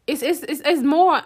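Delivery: usual speaking voice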